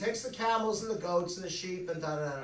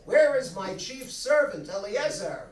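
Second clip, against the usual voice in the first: calling out; 3 semitones higher, 7 dB louder than usual. Acted. Abraham calls for his servant.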